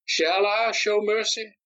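high, slow call to the crowd. Acted. Darius asks the watching crowd, calling out high and slow. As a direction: put the question to the whole crowd slowly. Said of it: "Darius called out to the spectators".

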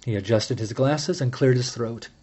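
ordinary delivery

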